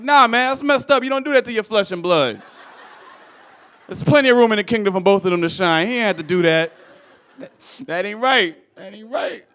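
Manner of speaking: hammed-up upset lament; mock tearful rant. Tearful ranting about Scar.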